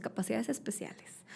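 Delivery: half-whisper. Real, not acted.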